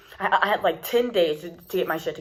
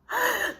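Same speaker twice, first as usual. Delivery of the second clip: high laugh; 4 dB quieter than usual. Real, not acted. Laughing at the driver.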